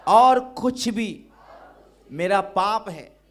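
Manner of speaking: crying out a confession, high and loud; fervent loud prayer. Real, not acted. Pastor leading the church in confession, loud fervent prayer. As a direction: pour out the sins at full force, pleading.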